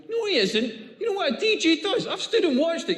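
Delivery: high, laughing outrage; ranting at the crowd about DJs, high indignant laugh. Real, not acted. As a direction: demand it, nearly laughing. Indignant rant.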